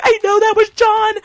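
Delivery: very high, loud squeal; excited shriek. Retold or imitated. Answering her friend after seeing John Mulaney, squealing. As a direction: squeal it like a thrilled fan.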